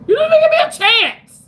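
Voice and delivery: overdone fake cry at someone who left; hammed-up wail. Viewer leaving the stream, hammed-up crying wail. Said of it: "well, that's just me".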